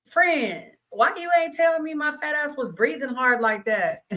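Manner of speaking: high-pitched, playful telling-off of a friend; mock complaint. Woman jokingly scolding her friend about her heavy breathing, mock complaint. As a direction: raise the pitch in mock indignation.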